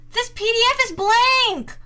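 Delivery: high, loud exclamation; loud dismay. Real, not acted. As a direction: cry out at the discovery. Finds the file blank.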